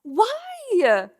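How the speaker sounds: mock enthusiastic hairdresser; eager hairdresser. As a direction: push an exciting new cut. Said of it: "He's like".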